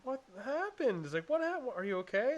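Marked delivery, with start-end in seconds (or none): 0.0-1.0 high, hushed mock alarm; slow, high-pitched dismay; alarmed fiancée. Fiancée voiced, alarmed by moaning. 1.3-2.3 high-pitched imitation of concern; mock worried questioning, much higher; alarmed fiancée. Fiancée voiced, checking on him.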